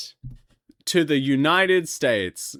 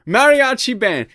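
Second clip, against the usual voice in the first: loud, higher burst of remembering; triumphant recall; 8 semitones higher, 8 dB louder than usual. Real, not acted. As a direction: blurt it out as it finally comes back. Finally remembers the word.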